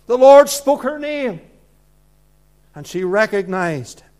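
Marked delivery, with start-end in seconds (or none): 0.1-1.4 loud projection. Real, not acted. Preacher proclaiming, raised voice.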